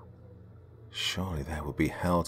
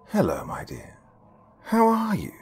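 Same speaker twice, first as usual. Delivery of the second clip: sly greeting. Acted. Sinister artist greets girl.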